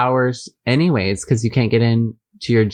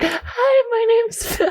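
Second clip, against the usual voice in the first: much higher, teasing mock voice; mocking imitation; slower than usual. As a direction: parody her put-on voice, way up high. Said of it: "Your voice is like".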